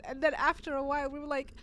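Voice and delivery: usual speaking voice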